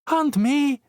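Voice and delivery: put-on very high small child's voice; piping up. Kip inserting herself to the adults, high child's pipe. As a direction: chirp it like a tiny girl. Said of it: "Kip piped up".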